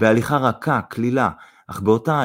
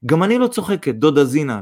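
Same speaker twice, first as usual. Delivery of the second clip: raised, higher-pitched earnestness; serious; 5 semitones higher, 3 dB louder than usual. A narrator's reading. Young woman assures her suitor's mother, serious. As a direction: insist earnestly that you mean it. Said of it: "ברצינות אמרה סוניה".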